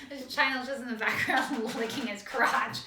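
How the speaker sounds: cracking up while getting the words out; laughing. Real, not acted. Co-host explains what is in the background, laughing. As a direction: talk through laughter, bursting into a high, loud laugh. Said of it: "why are you laughing at me".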